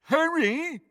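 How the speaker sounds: very high mock call; mocking mimicry. A narrator's reading. Crabbe mimics Ron's call, mockingly. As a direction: mimic someone's cry in a high voice. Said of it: "mimicked Crabbe".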